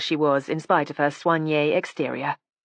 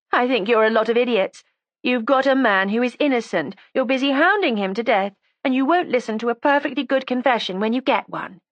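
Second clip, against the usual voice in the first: put-on high, defiant young woman; resentful young woman; 8 semitones higher than usual. A narrator's reading. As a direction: voice her as a sulky, stubborn girl. Judy's voice again.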